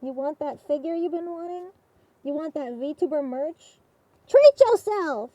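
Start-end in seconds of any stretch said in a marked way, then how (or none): 4.3-5.3 louder, slow excited cheer; excited shout. Real, not acted. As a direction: shout it out to hype them. Cheering viewers on.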